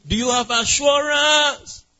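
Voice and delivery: high, slow, stretched question; drawn-out questioning. Real, not acted. Preacher asking a married sister about assurance, drawn-out questioning. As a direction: raise the pitch and hold the last word.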